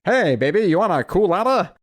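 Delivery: put-on high, loud sleazy old-timer; sleazy old-timey voice. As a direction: croon it like a greasy wiseguy. Imitates sleazy old-timey guy.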